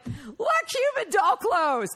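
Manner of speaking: high, loud, laughing and half crying; blurting it out through helpless laughter; laughing outburst. Retold or imitated. Telling her sister at the funeral home, laughing out loud. Laughing fit at casket showroom.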